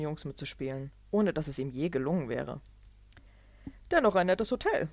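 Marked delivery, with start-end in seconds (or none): 3.9-4.9 loud, higher false praise; condescending businessman. Acted. Clark's voice.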